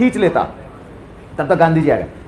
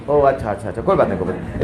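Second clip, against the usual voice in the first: meek fading apology; 11 semitones lower, 7 dB quieter, faster than usual. Acted.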